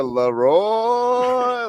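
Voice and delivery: LEROY imitated with the second syllable stretched out long; mock wrong emphasis. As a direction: mimic a botched "Leroy" by dragging out the ROY. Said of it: "put the wrong emphasis".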